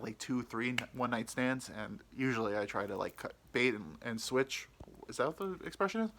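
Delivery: ordinary talk, nothing marked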